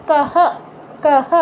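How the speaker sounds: "কহ" with a full h and the same short vowel echoed; full-h visarga. Shown as a demonstration. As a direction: sound both syllables of "কহ", h fully voiced out. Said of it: "বিসর্গ উচ্চারণ পূর্ণ হ এর মতো হবে". ("কহ", বিসর্গ পূর্ণ হ-এর মতো)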